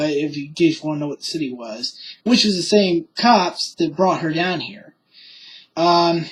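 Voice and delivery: normal talking voice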